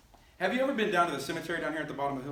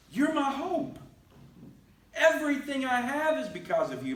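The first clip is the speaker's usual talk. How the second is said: David pleading; 9 semitones higher than usual. Acted. Voicing David's plea to God.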